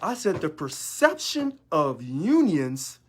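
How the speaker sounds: perception of unions said slowly, word by word; slow and deliberate. Shown as a demonstration. Leader insisting members hear his exact words, slow and deliberate. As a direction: lay out each word so they can't miss it. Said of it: "Listen to my words carefully".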